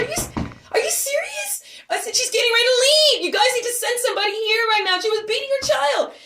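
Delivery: very high, incredulous outburst; frantic exasperation. Retold or imitated. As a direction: plead and scold at a frantic high pitch. Frantic at slow operator.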